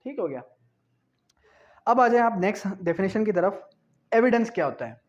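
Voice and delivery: ordinary delivery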